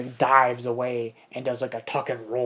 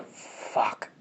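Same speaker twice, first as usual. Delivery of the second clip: a breathless whispered "Fuck" inside his head; whispered inner curse; 3 dB quieter than usual. Acted. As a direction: hiss the curse to yourself in panic. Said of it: "he's like".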